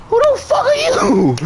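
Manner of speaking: very high mock-outraged cry; confrontational demand. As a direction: challenge the intruder in a squealed imitation. Retold standoff with intruder.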